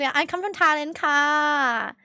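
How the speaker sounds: imitated Thai accent, lingering on the last syllable; playful Thai-English accent; put-on Thai accent. Shown as a demonstration. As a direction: switch into a mock Thai accent. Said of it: "I should have just switched my accent".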